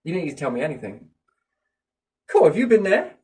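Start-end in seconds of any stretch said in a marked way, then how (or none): 2.3-3.1 high, loud, eager question; a loud mock cheery question; excited quote. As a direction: act out the keen reply. Acted exchange about accents.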